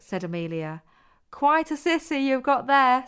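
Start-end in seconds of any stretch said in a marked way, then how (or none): 1.3-3.0 put-on teasing girl's voice; mocking, higher and louder. A narrator's reading. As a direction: jeer at him, pitched up. Zoya mocking the boy.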